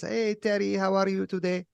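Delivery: high, loud singsong hello; imitating a chipper neighbor calling out; cheery neighbor greeting. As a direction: chirp it like a sunny old lady.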